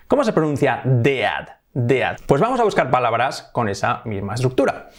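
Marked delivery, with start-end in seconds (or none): 0.8-2.2 "dead" read as deh-ad, both vowels; Spanish-style reading of "dead"; spelling pronunciation. Shown as a demonstration. Teacher asking how dead is pronounced, spelled-out reading. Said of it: "no decimos dead".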